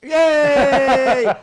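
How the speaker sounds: high, loud, laughing yay; excited cheer. Real, not acted. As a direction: cheer with delight. Cheering as the buttonhole finishes.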